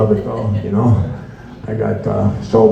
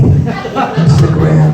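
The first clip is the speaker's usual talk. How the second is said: laughing drawl; 6 semitones higher, much slower than usual. Real, not acted. Drawn-out laughing repeat.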